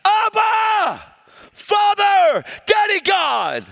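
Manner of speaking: very high, full-voiced shout; crying out. Spirit inside crying out to the Father, loud high shout. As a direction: cry out to God at the top of your voice. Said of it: "inside of me crying out".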